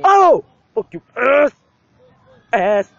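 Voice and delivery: loud furious outburst; raging gamer. Real, not acted. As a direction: curse out loud at the screen. Getting killed in game.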